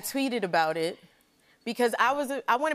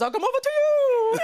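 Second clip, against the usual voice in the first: much higher and louder put-on voice; hammed-up David Blaine; 6 dB louder than usual. Retelling David Blaine's approach, high hammed-up voice. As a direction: overdo the smooth magician. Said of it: "So he goes".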